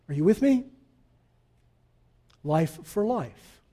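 usual speaking voice

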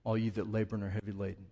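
soft, low, gently inviting; tender invitation. Acted. God welcoming the weary back, soft and tender. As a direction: call them home with open arms. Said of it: "holding his arms out wide and saying".

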